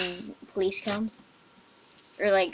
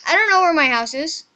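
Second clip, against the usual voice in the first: high, loud alarm; panicked; 6 semitones higher, 8 dB louder than usual. Real, not acted. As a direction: panic as night falls. Lost at nightfall in game.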